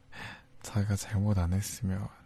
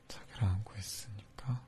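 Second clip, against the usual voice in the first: soft whisper stressing 자기랑; 5 dB quieter than usual. Acted. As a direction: whisper it right into her ear. Whispered while hugging.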